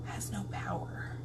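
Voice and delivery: unvoiced puzzled whisper. Real, not acted. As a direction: breathe it to yourself. Repeats the complaint a third time.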